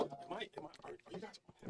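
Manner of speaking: soft breathy whisper, leaning in; hushed, unsure if live. Real, not acted. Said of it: "I feel the urge to whisper".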